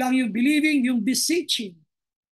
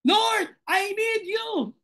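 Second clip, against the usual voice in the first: high, drawn-out cry to the Lord; heartfelt plea to God; 7 semitones higher, much slower than usual. Acted. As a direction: plead with God, pitched high and slow. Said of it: "Tell God how much you feel".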